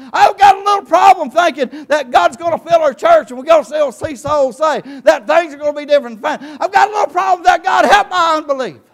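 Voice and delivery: fiery revival shout; preaching at full force. Acted. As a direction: shout it out to the whole congregation.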